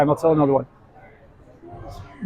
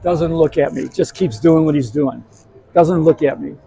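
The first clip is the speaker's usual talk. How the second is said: ordinary talk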